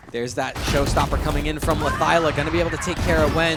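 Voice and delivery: shouting hype caster call; esports caster. Acted. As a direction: yell the clutch play-by-play.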